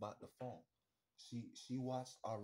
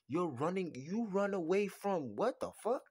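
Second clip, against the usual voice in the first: higher and louder, exasperated; loud rant; 5 semitones higher, 7 dB louder than usual. Acted. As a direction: yell at her in disbelief. Ranting at ladies.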